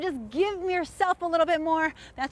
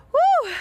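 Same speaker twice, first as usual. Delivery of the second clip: shouted woo, high and loud; loud high woo cheer; 9 dB louder than usual. Real, not acted. Cheering end of set.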